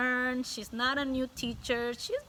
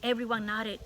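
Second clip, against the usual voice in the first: ordinary delivery, nothing marked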